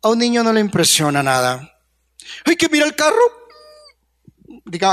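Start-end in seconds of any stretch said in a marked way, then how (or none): 2.4-3.5 high, gushing amazement; impressed onlooker. Retold or imitated. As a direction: swoon over the car. Imita a alguien impresionado. (voz aguda de asombro exagerado)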